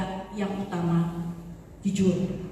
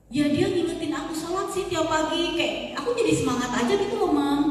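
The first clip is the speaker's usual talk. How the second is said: put-on teen daughter; 6 semitones higher than usual. Daughter voiced in mock dialogue.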